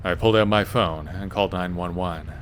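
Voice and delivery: plain reading voice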